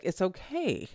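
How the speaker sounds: soft, calm it's okay; imitating her son soothing her; calm gaslighter voice. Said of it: "you say these things in a way where it's very calm".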